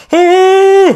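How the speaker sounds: high, loud mock shriek of terror; hammed-up startled scream; frightened scream. Sailor sees the dragon come up, mock frightened scream.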